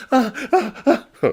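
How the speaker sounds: high, loud panting cries; terrified gasps. Acted. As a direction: gasp in terror. Terrified merchant collapses.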